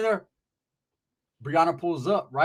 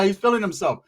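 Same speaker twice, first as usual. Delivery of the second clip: ordinary talk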